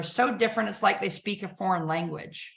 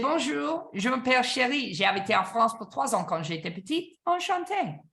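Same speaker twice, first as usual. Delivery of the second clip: light, airy French greeting said as a demo; speaking French; 3 semitones higher than usual. Shown as a demonstration. To customers who speak French, greeting them in French. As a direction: chirp it like a native Parisian. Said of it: "speak French".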